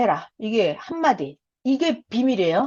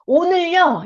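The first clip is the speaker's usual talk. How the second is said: 말 걸기 시작; 7 semitones higher, 4 dB louder, slower than usual. Shown as a demonstration. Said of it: "이렇게 한마디라도".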